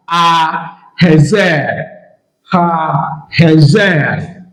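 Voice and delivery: very slow, word by word; slow demonstration. Shown as a demonstration. Teacher showing an example of penultimate accent, slow demonstration. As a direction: draw out "ha hezer" slowly for learners. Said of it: "I will show you an example".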